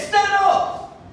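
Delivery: high, loud order with full authority; commanding shout. Acted. Jesus ordering the sick man to rise, loud command. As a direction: bark it like a command. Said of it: "he said it with some authority".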